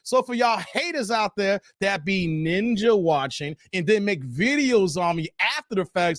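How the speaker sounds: ordinary talk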